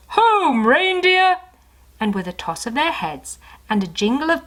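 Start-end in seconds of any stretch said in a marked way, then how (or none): 0.1-1.4 loud, much higher call. Acted. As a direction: call the reindeer home.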